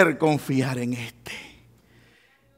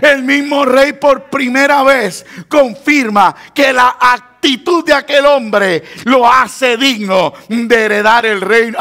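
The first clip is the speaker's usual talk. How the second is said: ordinary talk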